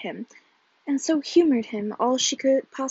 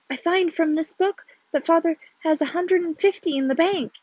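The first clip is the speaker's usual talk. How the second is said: higher, excited proclamation; exultant; 4 semitones higher, 4 dB louder than usual. A narrator's reading. Husband claiming his father's money, exultant. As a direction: boast of sudden riches, building to a hurrah.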